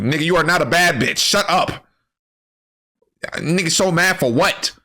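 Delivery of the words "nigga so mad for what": normal talking voice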